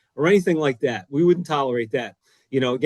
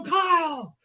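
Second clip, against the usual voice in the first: high, yelled name; screaming a name; 13 semitones higher than usual. Retold or imitated. Coach screams at his defensive end, high yell. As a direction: call him out from across the field. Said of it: "And I'm screaming at him".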